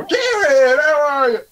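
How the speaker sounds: high, bright greeting like a mom on the phone; chirpy mock mom greeting Garrett; a hint of a cheerful mom; mom's phone voice.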